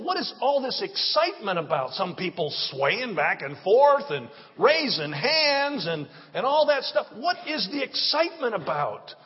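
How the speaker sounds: ordinary delivery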